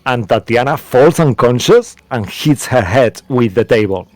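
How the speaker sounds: normal talking voice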